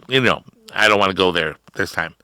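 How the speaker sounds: ordinary delivery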